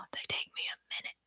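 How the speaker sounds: quick, unvoiced whisper; whispered aside. Real, not acted. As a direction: whisper it quickly under your breath. Whispered aside, then repeated aloud.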